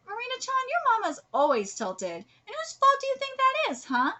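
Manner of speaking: high-pitched indignant character voice; acted retort. Acts a character's taunting retort.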